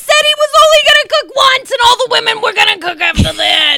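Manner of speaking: high, loud shouted rant; indignant yell. Shown as a demonstration. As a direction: yell it out in outrage. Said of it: "I yell this".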